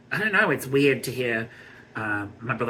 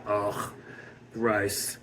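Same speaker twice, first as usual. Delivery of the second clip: disgusted; 4 semitones lower, 8 dB quieter than usual. Real, not acted. Disgust at rich celebrity world.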